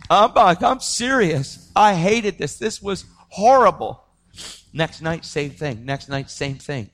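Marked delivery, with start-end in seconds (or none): 0.6-4.0 "serious" and "horrible" drawn out high; emphatic insistence. Real, not acted. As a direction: hammer home "horrible" slowly and higher. Insisting how much he hated relapsing.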